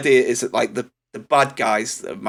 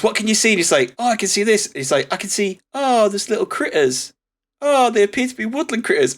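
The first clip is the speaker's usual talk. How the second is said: lightly voiced higher delight; mild wonder; 9 semitones higher than usual. Kurt Russell describing critters with mild higher wonder. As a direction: marvel at cute critters. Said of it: "he goes".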